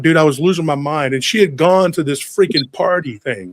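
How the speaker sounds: ordinary talk, nothing marked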